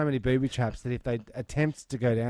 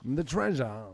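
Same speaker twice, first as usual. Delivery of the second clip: Christopher Walken impression; 3 semitones lower, 5 dB quieter, slower than usual. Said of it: "Christopher Walken".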